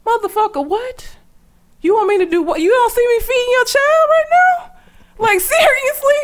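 high, indignant mock rant at the husband; incredulous outburst. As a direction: throw it back at him, outraged and disbelieving. Retelling confronting her ex's demand.

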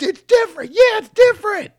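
high, loud gruff catchphrase; wrestling promo voice. Retold or imitated. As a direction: shout it like a dumb wrestler. Mocking Steiner catchphrase.

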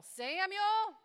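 loud, high call of the name. Acted. The Lord calling Samuel at night, a loud call. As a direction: call the boy from far off. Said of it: "the Lord called out to Samuel".

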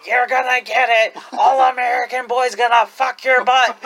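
loud heel taunt, laughing; heel yelling. Retold or imitated. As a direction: yell it like a wrestling heel. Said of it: "and he's".

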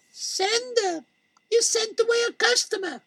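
sly shopkeeper voice, pitched high; Malky. Acted. Malky scolds Sender.

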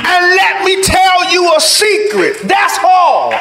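full-force preaching. Real, not acted. Preacher tells the church how hard forgiving is, full-force preaching. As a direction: preach it high and forceful to the congregation.

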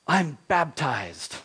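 slow, drawn-out declaration; declaring. Acted.

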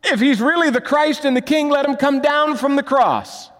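high, loud jeering taunt; mocking taunt. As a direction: taunt the man on the cross. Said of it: "the other was".

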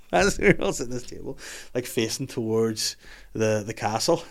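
ordinary talk

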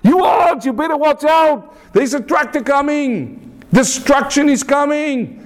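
high, urgent acted yell; acted cry of alarm, pitched very high. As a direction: shout down to the ants at the top of your pitch. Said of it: "he shouted louder".

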